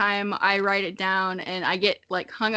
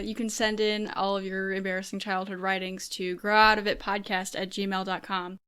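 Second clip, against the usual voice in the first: quieter recorded outro, even and steady; podcast announcement read; 2 semitones lower, 6 dB quieter than usual. Real, not acted. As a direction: read the outro notice evenly. Post-edited announcement read.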